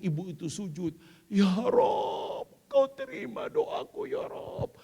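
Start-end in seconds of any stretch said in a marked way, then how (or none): 1.3-4.7 whisper-cry thanks to God; grateful prayer. Acted. Mother prostrate thanking God, tearful.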